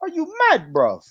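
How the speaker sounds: put-on British accent shooting high on "mad"; mock British accent. American mimicking British friends' catchphrase, mock British accent. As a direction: mimic British outrage, high then dropping. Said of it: "Y'all think y'all sound".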